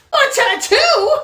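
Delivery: high-pitched shocked question; startled squeak. Acted. Fake dad hears the tattoo plan, startled squeak. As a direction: squawk it in alarm.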